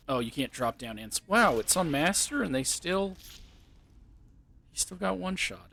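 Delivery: normal talking voice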